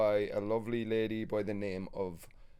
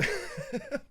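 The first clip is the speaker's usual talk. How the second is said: high squeaky laugh. Real, not acted. Laughing at missus joke.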